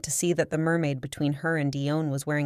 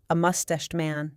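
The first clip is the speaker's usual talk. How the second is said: ordinary delivery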